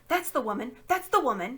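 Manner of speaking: loud, high accusing shout; crying out. Acted. Fat man accuses Jadis, shouting. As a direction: point and yell it out. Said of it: "cried the fat man".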